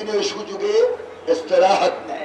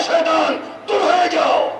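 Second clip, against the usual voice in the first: shouted command; 8 semitones higher than usual. Acted. Preacher voices command to Satan.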